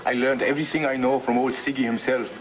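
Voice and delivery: hammed-up German analyst accent; bad German accent. Pupil of old Siggy analyzing dreams, mock German accent. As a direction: put on a silly, poor German accent. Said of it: "That's the worst German accent".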